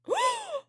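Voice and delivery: sharp, very high gasp; shocked gasp. Real, not acted. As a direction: gasp in disbelief. Shocked reaction while watching.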